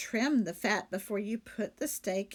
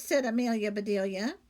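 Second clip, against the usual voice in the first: ordinary delivery, nothing marked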